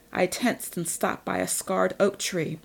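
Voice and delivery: plain reading voice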